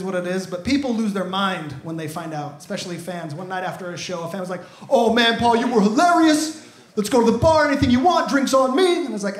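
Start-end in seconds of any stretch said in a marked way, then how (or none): 4.9-9.0 loud, high gushing fan voice; excited fan. Retold or imitated. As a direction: imitate an over-eager fan's invitation. Fan gushes after a show.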